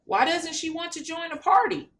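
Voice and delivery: Sparky the dog asks innocently; dog character voice. Acted. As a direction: ask like a puzzled little dog. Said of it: "Sparky says".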